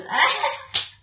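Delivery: high, loud burst of laughter; sudden laugh. Real, not acted. Laughs at own remark. (kusog nga katawa)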